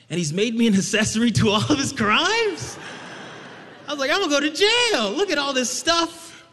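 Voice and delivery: high, loud comic panic; hammed-up alarm over the gifts; mock panic. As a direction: freak out about going to jail. Panicking about stolen gifts.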